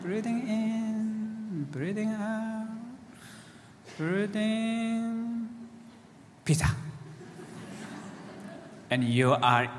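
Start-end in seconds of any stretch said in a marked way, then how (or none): 0.0-6.7 higher, softer, slow dreamy drift from breath to pizza; monkey mind voice. Acted. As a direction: play the eager, easily distracted monkey mind. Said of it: "And the monkey mind says".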